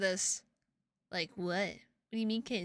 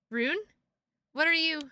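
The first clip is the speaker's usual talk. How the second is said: startled question; 6 semitones higher than usual. Acted. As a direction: ask it startled, as if caught off guard. Startled reading of the line.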